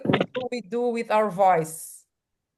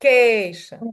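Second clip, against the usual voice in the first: education with CA louder and stretched; edu-CAAA-tion, louder on the tonic; stress on CA; 5 dB louder than usual. Shown as a demonstration. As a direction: hit and lengthen CA in education. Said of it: "what's the more important syllable?".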